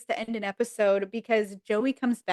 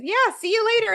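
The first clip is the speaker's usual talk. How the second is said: very high, louder sing-song goodbye; mock cheery [name]; 12 semitones higher, 5 dB louder, much faster than usual. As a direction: chirp it back mockingly. [name] mimics Eddie's goodbye.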